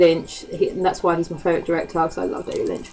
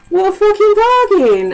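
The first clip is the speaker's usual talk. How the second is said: excited outburst; 11 semitones higher, 6 dB louder than usual. Real, not acted. Thrilled at a cheap DVD price.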